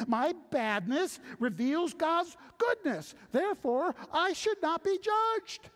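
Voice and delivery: high, loud, self-justifying argument; objector reasoning. Acted. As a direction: build the sinner's case to its triumphant end. Preacher voicing objector's logic.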